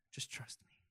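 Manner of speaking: breathy whisper, tossed off; whispered aside. Real, not acted. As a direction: lean in and whisper it as a joke. Aside to the congregation.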